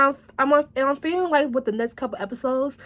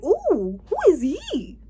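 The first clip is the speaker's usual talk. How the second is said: swooning ooh; 8 semitones higher, slower than usual. Retold or imitated. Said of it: "I was like".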